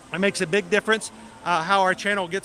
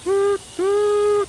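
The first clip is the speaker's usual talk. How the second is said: high, loud choo-choo toot; train whistle toot; 14 semitones higher, 6 dB louder than usual. Pretend. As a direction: mimic a steam train whistle.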